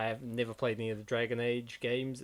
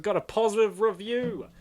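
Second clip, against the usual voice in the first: pleased; 8 semitones higher, 8 dB louder than usual. Real, not acted. Happy about praise.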